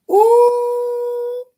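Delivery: a high excited squealing ooh. Real, not acted. Excited greeting to birthday viewer.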